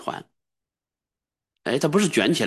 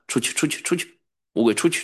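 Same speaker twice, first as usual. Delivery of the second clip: commanding exorcism; 6 semitones higher than usual. Shown as a demonstration. Demonstrates casting out a demon.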